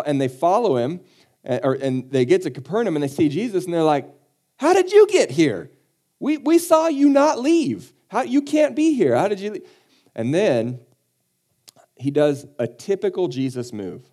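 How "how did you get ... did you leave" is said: very high, loud incredulous squeak; hammed-up astonishment, voice shooting way up; baffled disbelief. Followers finding Jesus across the lake, comically astonished.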